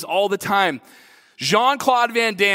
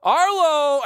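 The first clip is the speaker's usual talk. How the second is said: calling out; 9 semitones higher, much slower than usual. Retold or imitated. Father calling lost son louder.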